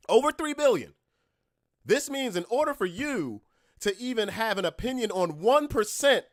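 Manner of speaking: furious lecture, shouting; angry rant. Real, not acted. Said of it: "Sorry, I got a little angry".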